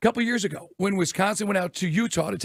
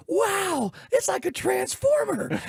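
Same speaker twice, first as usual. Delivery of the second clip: put-on high, slow, amazed little kid voice; child in awe at a car roof, high and slow; awed kid; 12 semitones higher, much slower than usual. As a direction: gasp it out like a wide-eyed child. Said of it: "the little kid goes".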